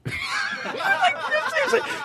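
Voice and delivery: shrieking laugh; real helpless laughing fit; high burst of laughter. Real, not acted. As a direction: break into a high cackle.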